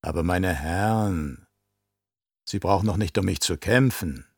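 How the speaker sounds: sultry, teasing woman's voice; obscenely suggestive. A narrator's reading. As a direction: draw out the opening, low and provocative. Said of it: "Mit geradezu obszöner Stimme sagte sie".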